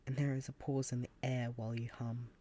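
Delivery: usual speaking voice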